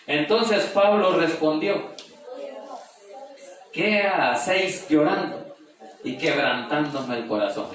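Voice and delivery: slow, high, loud preaching of the verse; stretched-out pulpit reading; emphatic scripture proclamation. Acted. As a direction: proclaim Paul's words slowly to the congregation. Preacher proclaims Paul's answer.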